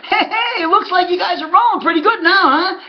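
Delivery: high, mocking, laughing voice; taunting laugh. Rival steward taunting the trainer on the phone, high mocking laugh. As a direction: needle him with a gleeful laugh. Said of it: "And he's laughing".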